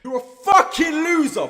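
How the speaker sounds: loud, high shouted insult; angry outburst. Real, not acted. Berating a rival YouTuber over his humor, angry shout. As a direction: explode at him, voice leaping up.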